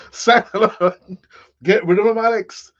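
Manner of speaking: hammed-up, unconvincing Irish voice; terrible Irish accent. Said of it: "that's a terrible Irish accent".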